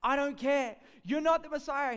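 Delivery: high, loud brush-off; loud retort. Retold or imitated. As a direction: snap back at him loudly. Brother retorts.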